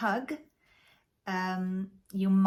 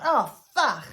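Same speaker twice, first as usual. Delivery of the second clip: loud, higher mock outburst; retold exclamation; 4 semitones higher, 8 dB louder than usual. Speaker recalling a slip in polite company, loud exclamation. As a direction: blurt it out loudly, acting out the slip.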